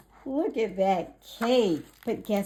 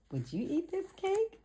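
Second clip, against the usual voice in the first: playful question; 12 semitones higher, 4 dB quieter, faster than usual. Acted. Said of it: "Friends, asks listeners".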